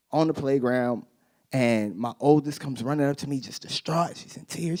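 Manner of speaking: ordinary talk, nothing marked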